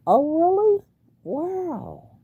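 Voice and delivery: high, loud oh really; real surprise at the news; surprised. Real, not acted. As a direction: genuinely caught off guard. Surprised by viewer's reply.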